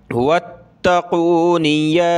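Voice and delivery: slow, drawn-out tajweed chant; slow Quranic recitation. Shown as a demonstration. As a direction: recite it melodically, stretching each vowel.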